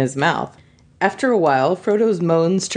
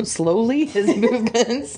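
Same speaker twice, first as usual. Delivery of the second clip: ordinary talk, nothing marked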